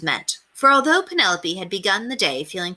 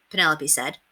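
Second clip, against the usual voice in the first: plain reading voice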